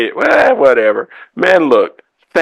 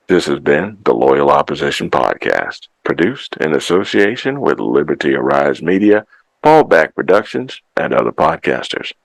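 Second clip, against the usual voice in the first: podcast sign-off; 9 semitones lower than usual. Acted. Podcast outro.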